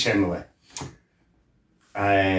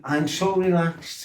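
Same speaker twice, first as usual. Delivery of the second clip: higher, slow sleepy contentment; dreamy relaxed; 5 semitones higher, 3 dB quieter than usual. Shown as a demonstration. Lad recalling his calm before the snap. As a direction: drift through it dreamily. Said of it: "goes from being".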